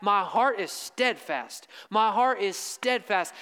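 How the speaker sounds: slow, weighty emphatic declaration; strong emphasis. Shown as a demonstration. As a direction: drive the verse home twice, slowly. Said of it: "I really wanna put a strong emphasis".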